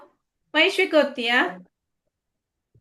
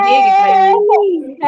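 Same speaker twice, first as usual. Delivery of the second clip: 1 mock crying; 5 semitones higher, 9 dB louder than usual.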